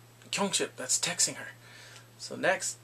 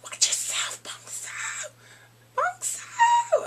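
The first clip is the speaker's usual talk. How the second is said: strained, very high mock squeal; sassy threat; 5 dB louder, much slower than usual. Feminine bully warns Bong Soo, sassy squeal. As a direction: imitate a bully's over-the-top sassy warning.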